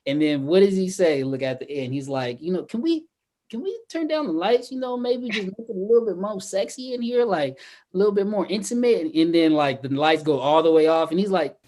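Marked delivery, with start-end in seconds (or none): 2.7-9.0 mock seductive. Imitating Marcus asking for dim lights.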